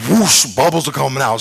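usual speaking voice

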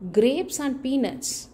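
ordinary delivery, nothing marked

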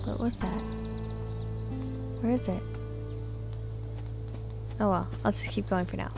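normal talking voice

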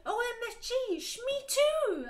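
loud, high mock shriek. Heroine shrieking to Satan about Slytherin, hammed-up. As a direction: shriek it like a giddy teen. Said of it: "I shrieked".

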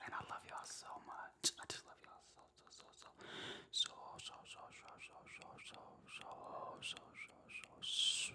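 soft excited ASMR whisper; breathy whisper trailing into repeats. Real, not acted. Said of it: "I feel like I'm supposed to be whispering it".

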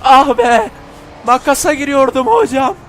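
high, loud frustrated cry; loud yelling. Real, not acted. Gamer yelling at the screen after a near crash. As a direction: shout out the near miss. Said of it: "Çok bağırıyorum ya".

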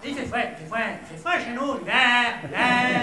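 broad comic Québécois French; hammed-up Québécois accent. Shown as a demonstration. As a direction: twist the vowels in a Québécois accent. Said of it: "c'est l'accent québécois".

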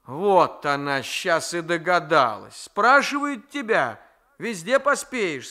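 put-on a grandmother snapping at a forward girl; scolding grandmother.

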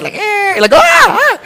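playful horror-movie yells; zombie groans. Pretend. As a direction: shriek like the risen dead. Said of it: "It's like Night of the Dead".

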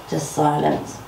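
usual speaking voice

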